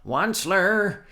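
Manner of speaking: high, loud croaking call; croaking cry. A narrator's reading. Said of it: "he cried with a cruffulous croak".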